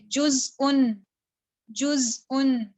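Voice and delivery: जुज़उन slowly, with a clean break before उन; cut hamza. Shown as a demonstration. Teacher demonstrating hamza, जुज़उन with a glottal cut. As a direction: hold the Z, then cut sharply into the vowel of जुज़उन. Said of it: "cut करके पढ़ेंगे".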